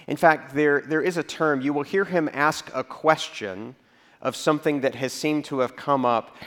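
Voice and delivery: ordinary talk, nothing marked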